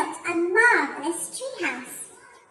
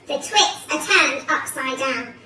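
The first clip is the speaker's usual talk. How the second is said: plain reading voice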